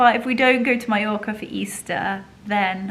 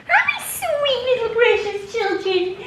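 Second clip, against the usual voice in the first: even higher sing-song coo; 14 semitones higher, 5 dB louder, slower than usual. Real, not acted. Greeting the dogs again.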